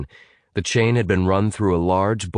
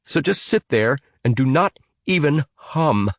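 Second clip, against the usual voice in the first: irritated warning; 8 semitones higher than usual. Acted. Evan warning Jonah.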